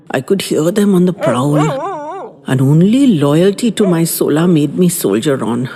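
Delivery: plain reading voice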